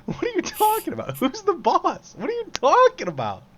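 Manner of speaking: incredulous. Real, not acted. Laughing disbelief.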